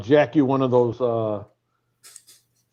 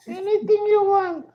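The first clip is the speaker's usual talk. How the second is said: mock housekeeper voice. Comic teasing the rock star as a falsetto housekeeper. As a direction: ham up a high-pitched hotel maid. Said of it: "I'll be like this".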